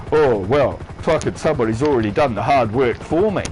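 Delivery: low-pitched, lightly mocking lazy cook. Chef mimics a cook leaning on someone else's recipe, lightly mocking lazy cook. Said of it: "just go".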